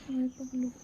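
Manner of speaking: ordinary delivery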